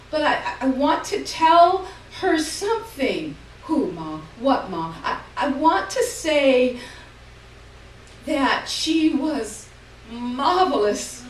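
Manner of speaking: high, loud, slowing to a halt; an old mother trying to tell her daughters something; old Ma's voice. A narrator's reading. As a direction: strain to get the last words out. Old Ma struggling to speak.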